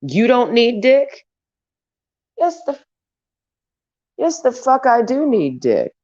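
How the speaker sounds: a real burst of incredulous outrage; indignant disbelief. Real, not acted. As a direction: fire it back, scandalized at the very idea. Incredulous comeback to imagined critics.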